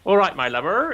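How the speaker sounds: lightly Cornish "All right, my lover", rising on "lover"; gentle Cornish accent; Cornish greeting. Shown as a demonstration. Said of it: "the Cornish dialect".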